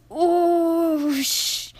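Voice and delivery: long high hiss of doused fire; hissing oosh. A narrator's reading. Percy's fire doused by water, long hiss. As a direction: hiss like steam.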